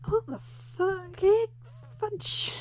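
high, shocked yelp; startled by something underfoot; sudden disgusted surprise; shocked outcry. Acted. Shocked discovery.

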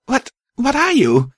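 high, startled stammer. A narrator's reading. Narrator backing away from a dead mare, stammering. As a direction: stumble over the first words, pitched high. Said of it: "I stammered".

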